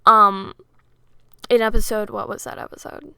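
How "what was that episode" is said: quick whisper to herself; under-breath muttered question; whispered aside. Real, not acted.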